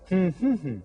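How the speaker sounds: hummed greeting. Shown as a demonstration. Teacher hums the morning greeting to the class. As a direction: hum the "good morning" melody. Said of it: "and I say".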